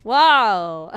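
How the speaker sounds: startled yelp at a jump scare; scared horror game streamer. Real, not acted. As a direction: jump back with a loud whoa. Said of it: "Why did you try to jump scare me?".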